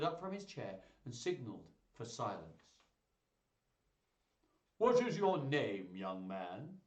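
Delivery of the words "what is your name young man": stately ducal questioning; imperious duke. Acted.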